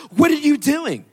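loud, high startled question; a high-pitched, startled "what are you doing"; shocked cashier. Retold or imitated. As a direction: blurt it out in shock. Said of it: "That's always the question".

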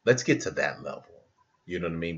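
ordinary delivery

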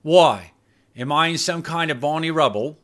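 sharp Cockney challenge; woman in Cockney rhyming slang, bristling; Cockney accent. Acted. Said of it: "her Cockney accent".

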